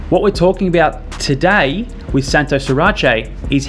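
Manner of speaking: podcast intro read. Acted. Podcast intro read over music.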